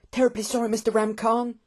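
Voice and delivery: high, sheepish apology; apologetic young Hector. A narrator's reading. Hector apologising to Mister Ramcarn, sheepish. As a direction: pipe up high and contrite.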